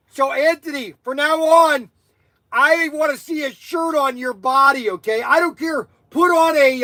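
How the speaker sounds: high, worked-up scolding; too excited. Real, not acted. Streamer ordering Anthony to wear a shirt, overexcited. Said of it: "I just get too excited".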